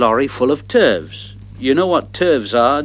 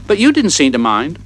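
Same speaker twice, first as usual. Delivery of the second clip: ordinary delivery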